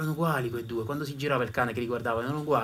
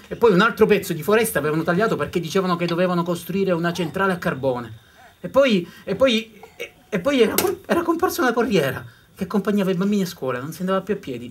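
louder, higher rush of complaints; building indignation; 6 semitones higher, 7 dB louder than usual. A narrator's reading. Storyteller listing the forest's losses with growing heat. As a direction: pile up the changes with rising heat.

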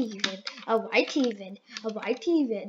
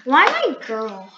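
loud wail; 8 dB louder than usual. Real, not acted. Kid wails at game character.

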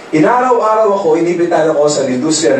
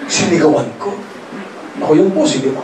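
normal talking voice

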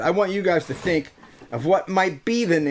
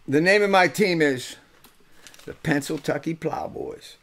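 ordinary talk, nothing marked

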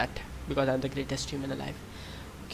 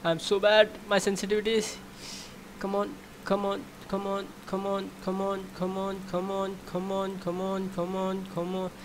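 tense coaxing under breath; high-pitched urging; 5 semitones higher than usual. Real, not acted. Urging himself during game.

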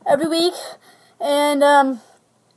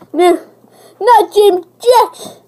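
shouting no; 6 semitones higher, 6 dB louder, slower than usual. Pretend. Shouting refusal.

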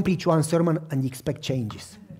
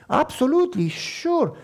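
eager agreement; 8 semitones higher, slower than usual. Retold or imitated.

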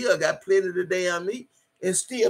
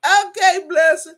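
much higher and louder, squealing with delight; excited squeal; 11 semitones higher, 8 dB louder than usual. Real, not acted. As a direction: burst out in delighted glee. Reacting to chat with laughter.

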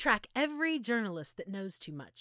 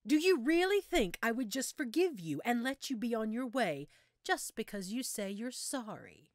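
ordinary talk

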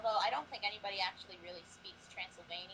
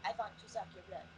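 mock Transylvanian accent. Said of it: "the Transylvanian accent is a little too".

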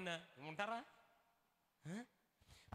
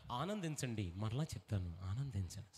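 mock solemn epitaph; 12 semitones lower than usual. Epitaph from app read out.

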